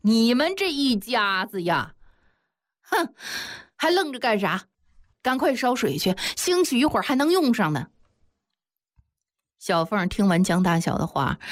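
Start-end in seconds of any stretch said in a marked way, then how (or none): 0.0-7.9 exasperated, higher-pitched ordering about; huff, then chivvy her along impatiently. A narrator's reading. Neighbour sends bewildered woman to boil water, impatient. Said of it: "马上就不耐烦地说".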